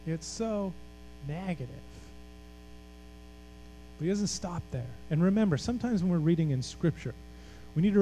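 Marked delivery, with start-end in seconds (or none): 0.1-1.7 hushed, slow voicing of despair; depressed frustration. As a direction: let it sink, softly and slowly, with heavy pauses. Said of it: "That's Micah's frustration".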